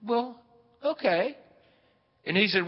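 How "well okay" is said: high, halting acceptance; pause, then agree in a small high-pitched voice; coy, acted reluctant yes; hesitant Eloise. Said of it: "And she said".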